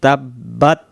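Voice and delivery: drawn-out melodic recitation; chanted Quranic recitation; slow Quran recitation. Shown as a demonstration. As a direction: recite it slowly and devoutly. Recites verse slowly.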